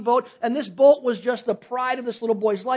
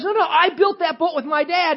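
put-on higher, pleading protest of a child; a boy's higher, earnest protest; insistent boy; 7 semitones higher, 3 dB louder than usual. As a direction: insist like a kid defending his boat. Boy insists to store owner.

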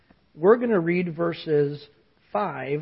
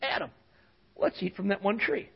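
Eve tempting; 10 dB quieter than usual. Acted. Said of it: "What do they go?".

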